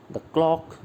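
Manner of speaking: quick "the", weighted CLOCK in "the clock"; stress on CLOCK. Shown as a demonstration. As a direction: land on "clock". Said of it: "clock पर है".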